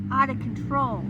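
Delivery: loud, stressed out of control; emphatic. Real, not acted. Explaining hysteria, stressing out of control, loud and emphatic. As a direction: stress the words hard. Repeated phrase, first take.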